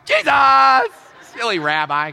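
loud mock call for a lost Jesus; hammed-up shout searching the house. Pastor pretending to search for Jesus, mock shouting.

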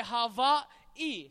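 Hawaii drawn out with a V for the W; Hawaiian pronunciation. Shown as a demonstration. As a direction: teach Hawaii slowly, W said as V. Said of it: "it's a V pronunciation".

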